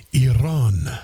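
Iran as a low, loud "ee-RAHN"; exaggerated proper pronunciation. Host mocking the correction, Iran intoned "ee-RAHN".

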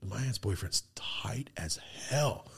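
slow, very soft whispered admiration; awed whisper. Retold or imitated. Kid quietly admiring his aunt's boyfriend, awed whisper. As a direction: whisper it slowly, truly impressed. Kid's awed private verdict.

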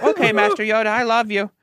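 half-hearted gushing thanks; mock fan. You thanking the Yoda puppet, mock gushing. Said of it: "And you're just like".

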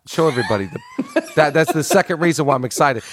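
laughing through the words; teasing laugh; giggling about the back-cover photo. Real, not acted.

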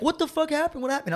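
high, shocked question; alarmed high-pitched what happened. Retold or imitated. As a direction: ask like you can't believe what you're seeing. Friends shocked at missing tooth.

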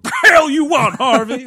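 loud, high tough-guy holler; hammed-up combative yell; mock shout. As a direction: shout it down as a no-nonsense mayor. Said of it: "That one shouting at the mayor".

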